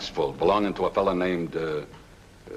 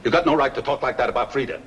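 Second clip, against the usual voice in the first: fast, high, loud protest; angry retort; 6 semitones higher, 4 dB louder, faster than usual. Acted. Brother-in-law defending his sister Frida, angry and fast. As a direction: snap back hotly.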